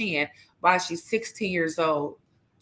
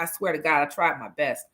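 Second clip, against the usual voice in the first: normal talking voice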